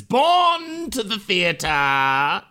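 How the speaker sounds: high, drawn-out theatrical declaration; hammed-up thespian. Host announces actor's theatrical parentage, mock-grand and drawn out. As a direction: play the grand stage actor, stretching the words.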